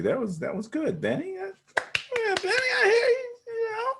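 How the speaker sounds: high, cracking mock-tearful voice; hammed-up teary praise; mock emotional. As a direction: pretend to choke up with emotion. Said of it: "if the alcohol's talking and you're talking like that".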